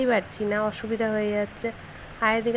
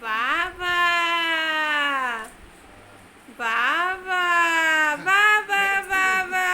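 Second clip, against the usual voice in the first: calling out; 6 semitones higher, much slower than usual. Real, not acted. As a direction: call the little one sweetly. Calling the baby, high.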